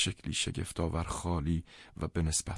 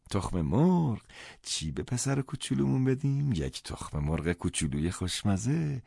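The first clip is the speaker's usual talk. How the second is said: put-on higher, slowing, cooing to a baby; baby talk; 4 semitones higher than usual. Carrying the baby around the kitchen, cooing baby talk. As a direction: coo it like talking to an infant.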